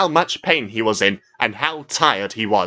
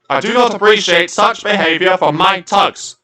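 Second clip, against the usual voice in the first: higher, louder stern scolding; 9 semitones higher, 6 dB louder than usual. A narrator's reading. The dockmaster scolds Top Hat, louder and higher. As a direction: tell off the tug firmly. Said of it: "I scolded".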